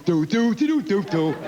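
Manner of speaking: high seagull doodling; a grown man doing a seagull. Retold or imitated.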